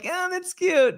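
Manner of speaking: high, airy brush-off; mock dismissive. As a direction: wave it away like a bored star. Stars dismissing the children's charity.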